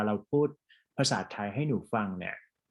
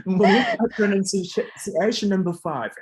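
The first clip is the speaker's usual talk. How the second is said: higher and louder, upbeat call to move on; brisk teacher cue, higher and louder; energetic announcement; 8 semitones higher, 7 dB louder, much slower than usual. Real, not acted. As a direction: announce the next task with a burst of energy. Tutor announces next exercise.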